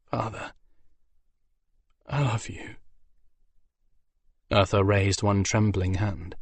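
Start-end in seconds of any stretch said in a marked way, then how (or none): 0.0-0.5 choked, much softer; words catching in a tight throat; broken. Acted. Griffin son to his dying father, choked and broken. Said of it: "a broken". 2.1-2.8 choked, much softer; the words barely get out; broken. Acted. Griffin son telling his father goodbye, broken. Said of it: "a broken".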